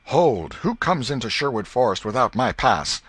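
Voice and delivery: ringing high shout of Hold; loud challenge. Acted. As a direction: call out like a forest outlaw stopping a stranger. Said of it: "Tom called".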